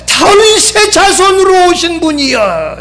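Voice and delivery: high, ringing address to the Lord; exalted calling out. Retold or imitated. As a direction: call it out with the last word drawn long. Reciting the address to Jesus.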